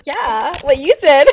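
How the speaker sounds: high, loud giggling reply; laughing. Real, not acted. Laughing at her friend's speech.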